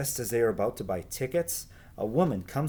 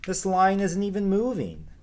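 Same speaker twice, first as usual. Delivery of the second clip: higher-pitched impatient grumble; complain, fed up with the line; 9 semitones higher than usual. A narrator's reading. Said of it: "Beth complained".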